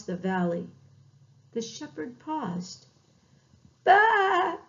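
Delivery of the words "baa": high, loud lamb's call; distant bleat. A narrator's reading. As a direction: bleat as if calling from far away. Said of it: "he heard in the distance".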